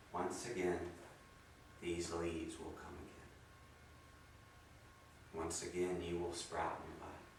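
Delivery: low, soft, unhurried meditation voice; guided meditation. Acted. Guiding listeners as falling leaves, soft meditation voice. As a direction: ease them through letting go like autumn leaves.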